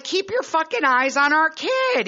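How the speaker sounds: high, exasperated telling-off; retold angry scolding. Mother retells scolding partner about their kid, exasperated. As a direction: scold a partner who wasn't watching.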